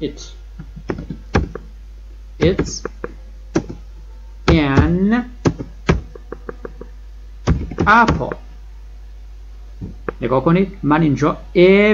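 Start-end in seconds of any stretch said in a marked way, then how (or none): 0.0-8.3 slow word-by-word drill. Shown as a demonstration. Teacher drilling the class, slow separated words. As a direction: spell the sentence out piece by piece. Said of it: "It به کار ببرید".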